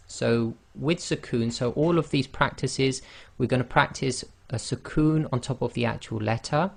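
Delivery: ordinary delivery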